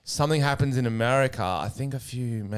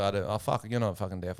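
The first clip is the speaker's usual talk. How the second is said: low, softer aside; retold rude remark; 3 semitones lower, 7 dB quieter than usual. Comic doubts an audience member, low retold remark. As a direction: say it lightly, under the voice. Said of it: "I said something quite rude".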